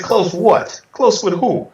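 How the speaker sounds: incredulous retort. Retold or imitated. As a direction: snap back in disbelief. Stunned by friend's report.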